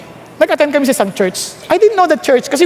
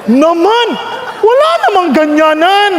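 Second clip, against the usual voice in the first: playful outraged whine; laughing protest; 5 semitones higher than usual. Pretend. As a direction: protest God's answer with a laugh.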